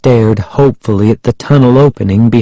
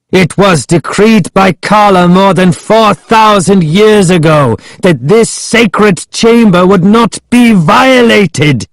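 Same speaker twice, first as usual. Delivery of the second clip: Ahmed crying out; 9 semitones higher than usual. A narrator's reading. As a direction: proclaim an ancient decree, pitched high. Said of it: "he cried".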